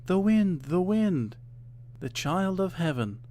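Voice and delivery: put-on high children's voices chanting; children answering. A narrator's reading. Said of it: "the children answered".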